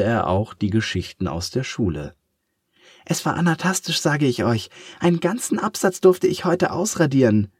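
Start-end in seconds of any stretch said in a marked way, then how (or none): 3.1-7.5 higher, eager eraser voice; enthusing little eraser; gushing. Acted. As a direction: gush about the day at school. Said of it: "schwärmte die kleine blaue Ananas".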